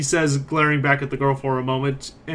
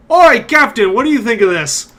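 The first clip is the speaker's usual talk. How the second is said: high, loud jeering call to the captain; 7 semitones higher, 8 dB louder than usual. Acted. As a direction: shout it across the square, mocking. Mercenary calls out to captain.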